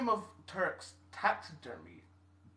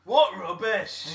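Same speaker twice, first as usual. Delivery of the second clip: Scottish exclamation; 4 semitones higher, 11 dB louder, much slower than usual. Pretend. Mock Scottish outburst.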